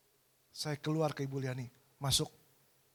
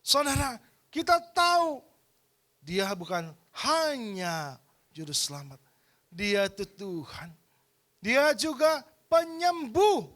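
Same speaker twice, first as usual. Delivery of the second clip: loud, high rousing call to faith; preaching at full force; 5 semitones higher, 7 dB louder, slower than usual. Acted. Preacher rousing the congregation to believe, loud and rising. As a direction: lift the congregation with a soaring cry.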